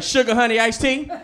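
fussy mom demanding air freshener; mom complaining. Retold or imitated. Mom scolding the family about a smell, loud. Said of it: "she'd be like".

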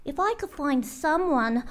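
high, lazy little bird voice, slow at the end; a pampered bird sighing for a break; lazy songbird voice; Maisie the bird. Acted.

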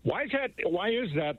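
ordinary delivery

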